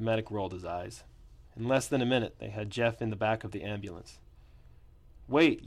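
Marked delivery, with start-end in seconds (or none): 5.3-5.6 loud high shout; yell. A narrator's reading. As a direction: shout for them to wait. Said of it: "yelled Chris".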